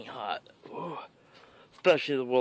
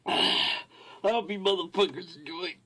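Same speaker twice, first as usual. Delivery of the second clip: normal talking voice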